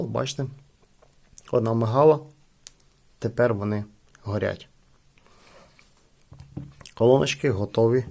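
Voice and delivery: ordinary talk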